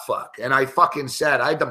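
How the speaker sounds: ordinary talk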